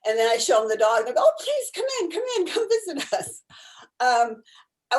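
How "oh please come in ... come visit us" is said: very high, gushing invitation; imitated eager welcome. Patient spots the dog at the door, high gushing welcome. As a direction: act out delighted begging, pitched way up.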